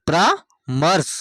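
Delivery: परामर्श said very slowly, sound by sound, र drawn before स; teacher drawing out परामर्श slowly syllable by syllable; slow spelled-out demo. Shown as a demonstration. Teacher showing pupils where र falls, slow careful pronunciation. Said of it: "यानी इसका उच्चारण स के, स से पहले".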